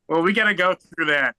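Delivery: ordinary talk, nothing marked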